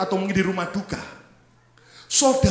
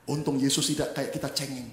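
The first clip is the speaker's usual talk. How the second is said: ordinary talk, nothing marked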